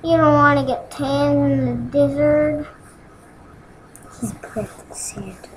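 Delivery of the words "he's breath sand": slow breathy whisper. Pretend. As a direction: whisper it slowly. Whispered aside.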